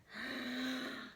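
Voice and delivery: startled intake of breath; sharp gasp. Acted. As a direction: gasp in alarm. Gasp before dinner.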